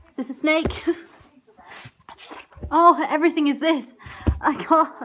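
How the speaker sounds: normal talking voice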